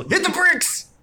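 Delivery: very high, loud acted shout; high-pitched, hammed-up yell; catchphrase yell. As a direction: holler it like a signature battle cry. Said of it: "He'll just yell".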